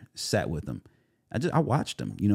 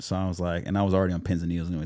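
ordinary talk, nothing marked

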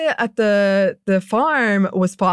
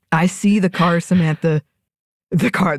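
usual speaking voice

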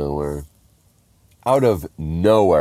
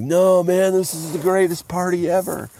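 put-on drunk reveler protest; 10 semitones higher than usual. Drunk friend coming to, insisting the party goes on, high-pitched protest. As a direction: whine it high like a party diehard.

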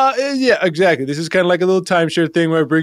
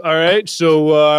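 usual speaking voice

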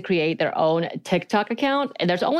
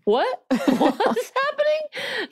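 high, slow, laughing disbelief; baffled disbelief; slower than usual. Retold or imitated. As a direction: squeal it out, laughing in confusion. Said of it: "And I was like".

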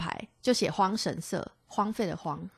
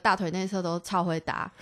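ordinary talk

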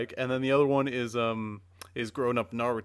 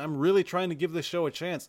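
ordinary talk, nothing marked

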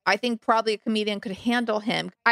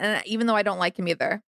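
usual speaking voice

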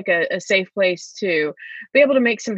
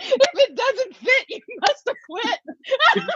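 slow, higher, laughing twist on a famous line; laughing parody; slower than usual. Pretend. Joking about the chapel bells with a courtroom parody, slow laughing. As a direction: drag the parody out slowly while laughing. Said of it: "It's like the glove with O J".

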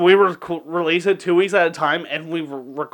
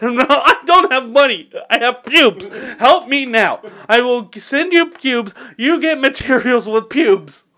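yelling back; 6 semitones higher, 4 dB louder than usual. Retold or imitated. Drunk caller haggling with spell caster, yelling back. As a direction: mock-desperate yell, pitched up and louder. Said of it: "you start yelling back".

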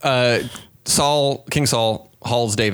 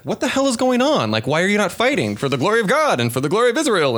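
higher, hammed-up outrage; mock indignant outburst; 9 semitones higher than usual. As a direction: demand answers like a fired-up kid brother. David outraged at brothers' inaction.

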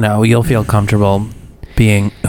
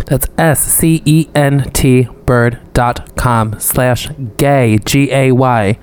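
careful slow ad readout; slow spelled out; much slower than usual. Acted. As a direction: spell it slowly, letter by letter. Spelling out ad URL.

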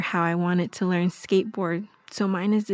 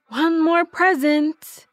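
raised, slow, drawn-out announcement; louder and higher, stretching it out to present a surprise; announcing a gift; 6 semitones higher, 5 dB louder, slower than usual. Acted. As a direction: sing out the big reveal slowly. Acting Edgar announcing a gift.

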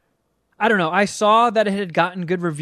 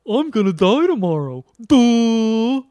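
louder, dim-witted "I'm gonna die tomorrow. Duh."; hammed-up dopey doom; dopey mock voice; 5 semitones higher, 6 dB louder, slower than usual. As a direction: play it like a doomed airhead. Mocking partygoer in the movie.

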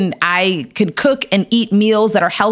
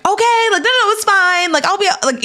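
much higher, bright forced cheer hiding panic; fake brave face; 12 semitones higher than usual. Reassuring her parents she's fine, forced high cheer. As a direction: pretend it's fine while falling apart inside. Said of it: "but really I was, like, breaking down inside".

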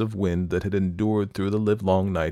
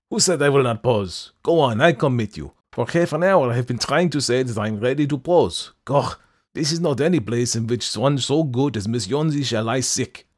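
put-on blustering German-accented painter, louder; gruff German old man; 6 semitones higher, 5 dB louder than usual. A narrator's reading. Old painter to Sue, gruff German bluster.

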